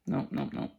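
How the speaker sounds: ordinary delivery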